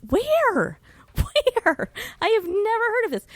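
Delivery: high, disbelieving cry of Where; shocked, laughing disbelief, fast; incredulous surprise. Real, not acted.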